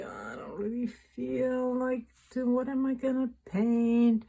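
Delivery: whiny, unwilling self-quote; sulky I-don't-want-to voice; reluctant inner voice. Retold or imitated. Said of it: "I'm like".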